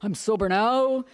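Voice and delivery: slow, self-satisfied mock husband voice; lightly voiced husband, drawn out and pleased with himself; mimicking the sober husband's slow demand; smug newly sober husband. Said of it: "they go from being the scum of the earth to".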